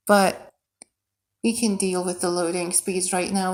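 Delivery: usual speaking voice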